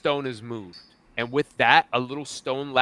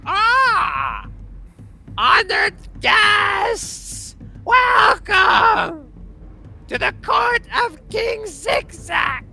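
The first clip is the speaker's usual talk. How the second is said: very high, loud, slow shouted welcome; shrill kobold herald; 8 dB louder, much slower than usual. Acted. The kobold welcomes the guests, very high shouting. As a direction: announce the king like a squeaky herald. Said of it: "he looks at you and goes".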